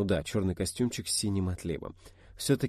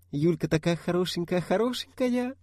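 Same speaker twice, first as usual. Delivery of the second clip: high cooing lisp, much higher; cutesy baby talk; 11 semitones higher, 3 dB louder than usual. Acted. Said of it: "засюсюкала сестрёнка".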